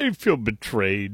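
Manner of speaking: low, whiny mock imitation of the clip; host mimics the pundit's words back to ridicule him; mocking Chuck Todd. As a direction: parrot his line back with contempt.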